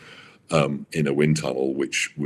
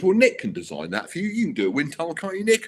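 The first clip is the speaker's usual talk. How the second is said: eager Max pitching; 13 semitones higher, faster than usual. Retold or imitated. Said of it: "And, and Max goes".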